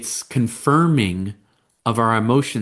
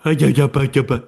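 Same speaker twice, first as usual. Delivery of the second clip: louder, bouncy mock baby talk; imitated baby babble; 3 semitones higher, 4 dB louder, faster than usual. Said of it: "the baby doesn't have language yet".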